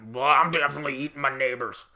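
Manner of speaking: loud, guttural rough impression; Alex Jones. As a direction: growl it out like an outboard motor. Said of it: "Alex Jones has the voice and the jowls".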